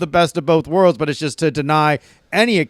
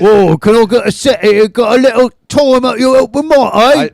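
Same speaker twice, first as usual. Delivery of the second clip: higher, louder mock Cockney; Cockney accent; 7 semitones higher, 5 dB louder than usual. Mocking an American doing British, loud high Cockney. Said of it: "talking like a Cockney".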